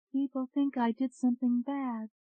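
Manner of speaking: high, faraway trance voice; hollow, distant. A narrator's reading. Hypnotized prisoner explaining to doctor, hollow and distant. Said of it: "Her voice sounded hollow".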